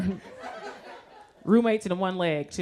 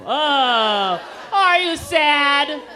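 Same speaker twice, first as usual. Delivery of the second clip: loud, drawn-out fake pity; exaggerated "poor you" condolence; mock sympathy; 5 semitones higher, 6 dB louder, much slower than usual. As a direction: coo loudly and high, stretching the words. Mock pity for neglected patient.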